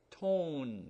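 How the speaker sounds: "tone" with a long O like the letter name. Shown as a demonstration. Teacher showing "tone" takes a long sound. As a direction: round out the O in "tone". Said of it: "long sound".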